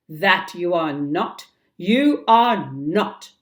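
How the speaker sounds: loud, slowing insistence; shouted denial. Acted. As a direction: shout it back, refusing. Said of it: "Hook shouted back".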